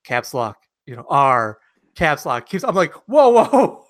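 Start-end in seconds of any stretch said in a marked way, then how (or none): 3.1-3.9 loud, high mock-alarmed whoa; alarmed protest. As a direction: cry out to halt someone, exaggerated. Acting out alarm at trainee.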